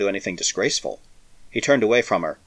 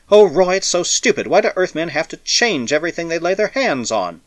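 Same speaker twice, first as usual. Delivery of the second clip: anguished; 7 semitones higher, 3 dB louder than usual. A narrator's reading. Despairing protest.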